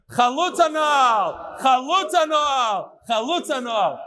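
slow, high, drawn-out shout; group ritual call. Pretend. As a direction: call it out ceremonially for everyone to join. Whole group calls out ritual phrase.

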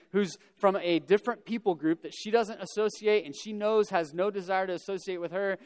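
ordinary talk, nothing marked

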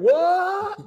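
high, drawn-out mock surprise; mock astonishment. Preacher voices surprised listeners out there, mock astonishment. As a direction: exclaim like a shocked listener, hammed up. Acts out listeners' shocked reaction.

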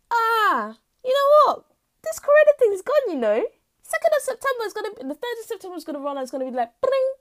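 high, loud, fast mocking cheer; mock carefree optimist. People expecting corona gone in September, in a high mocking voice. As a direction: parody the naive optimist.

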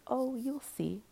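high, knowing sing-out of a dark thought; imitated taunt, lifted high; inner voice taunting. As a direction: pitch it up like a voice that knows better. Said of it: "And then it was like".

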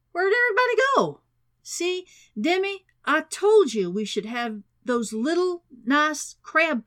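high, loud, grinning president voice; jovial president. A narrator's reading. Grinning president teasing a thinned-out room, high and jovial. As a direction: call out heartily in a high, playful voice.

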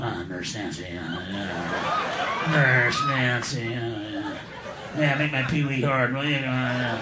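very low, lewd groaning Pee Wee voice, laughing; sleazy growl of a man in an adult theater; Pee Wee moaning. Retold or imitated. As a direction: leer through it, dropped way down and soft. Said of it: "an adult theater going".

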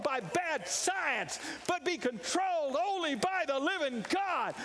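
rising, impassioned preacher's call; full-force preaching. Acted. Preacher rallying the congregation, full-force preaching. Preacher building to sung praise.

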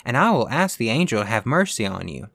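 usual speaking voice

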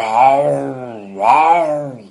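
loud imitated roar, drawn out; lion's roar. A narrator's reading. Big lion at the pit's edge roars at little dog. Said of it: "大狮子追到泥坑边儿".

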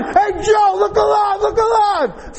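very high, full-throated holler; imitating a coach at full yell. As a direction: scream it down the baseline at a player.

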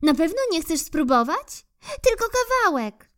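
high coaxing squirrel voice. Acted. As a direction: coax the bear up the tree, voice pitched high. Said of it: "namawiała".

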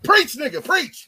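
loud, high laughing "preach"; hyped cheer. Real, not acted. Friend cheering on a rant, loud laughing call. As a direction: cheer him on like a congregation.

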